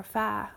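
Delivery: "fa" as f plus a voiced ah; f with open vowel. Shown as a demonstration. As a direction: say "fa" with an ah after the f. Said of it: "parents want to say f".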